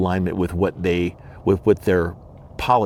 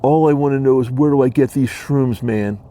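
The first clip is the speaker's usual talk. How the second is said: louder, higher-pitched imitation of a pushy commenter; put-on shroom-seeker voice, pitched up; mock commenter voice; 4 semitones higher, 5 dB louder than usual. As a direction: imitate a viewer demanding shrooms, loud and raised. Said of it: "So they comment on my videos".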